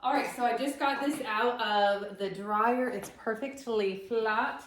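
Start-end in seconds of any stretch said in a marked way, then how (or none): none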